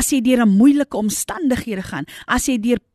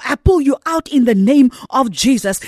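louder, higher impassioned preaching; fervent pulpit proclamation; preaching at full force; 3 semitones higher, 3 dB louder than usual. Acted. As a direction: pull them out with every line.

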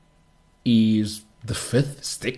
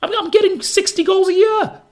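high, louder mock prima donna; Cristiano Ronaldo; 5 dB louder than usual. Pundit voicing the boastful star striker, high and loud mockery. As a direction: preen like a star bragging. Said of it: "Cristiano Ronaldo and his prima donna stuff".

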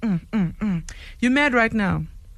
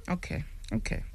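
ordinary talk, nothing marked

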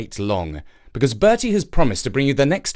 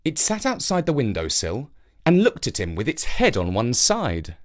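usual speaking voice

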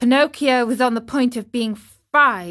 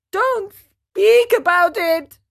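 much higher, slow wail; 10 semitones higher, 5 dB louder, slower than usual. Acted. Pinocchio begging the dog to stop, wailing. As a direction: wail it out in dismay. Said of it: "wailed Pinocchio".

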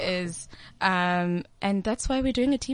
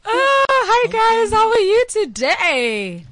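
much higher, louder, slow sing-song hello; hammed-up high greeting; 11 semitones higher, 6 dB louder, slower than usual. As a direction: greet them like a cheesy TV host. Host's hammy greeting after intro.

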